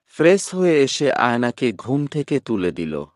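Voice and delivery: usual speaking voice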